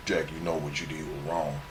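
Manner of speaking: low, hushed imitation of a scolding; imitated soft-spoken telling-off; quiet reproach. Acts out Taz's reproach afterwards.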